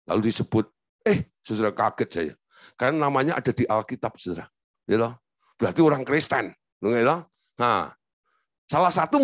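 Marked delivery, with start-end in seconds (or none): none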